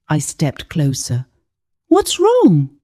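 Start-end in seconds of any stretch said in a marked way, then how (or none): 1.9-2.7 put-on small, high child's voice; worried child. A narrator's reading. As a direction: ask it like a worried little girl. Child asks crying mother.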